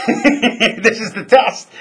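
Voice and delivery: slow, loud, laughing aha; laughing realization. Real, not acted. Realizing the sergeant is testing him, laughing. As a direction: drag it out through laughter.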